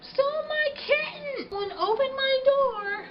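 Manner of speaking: high, shrill-pitched frantic yelling; imitating a hysterical woman yelling; mock screaming woman. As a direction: play the frantic neighbour who lost her kitten. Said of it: "comes in screaming at me saying".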